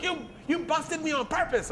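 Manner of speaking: high-pitched indignant voice; mock outraged accusation. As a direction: imitate a wronged friend, voice shooting up. Fuming friend accuses him.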